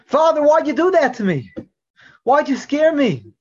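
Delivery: put-on high, loud hurt child's protest; a boy shaken and reproachful toward his father; a frightened son protesting to his father; upset child. Child confronts father after scare.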